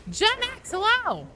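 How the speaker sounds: high, loud cheery hello; excited greeting. Real, not acted. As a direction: greet the viewer with big energy. Greeting a chat viewer.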